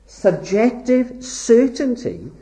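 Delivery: ordinary talk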